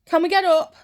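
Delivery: put-on eager child. Young self begging mum on Christmas morning, eager. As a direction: beg to get up now. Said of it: "I woke my mum".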